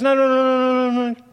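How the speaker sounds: high, loud panicked no-no-no; vehement denial. Acted. Peter frantically denying he will ever fail Jesus, high and loud.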